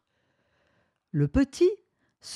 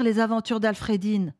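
usual speaking voice

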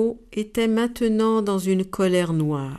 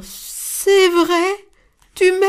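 much higher, delighted; pleased hiss; 11 semitones higher, 6 dB louder, much slower than usual. Acted. The pleased snake replies to the dove, high and delighted. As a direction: sizzle with pleasure. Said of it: "en siffla de plaisir".

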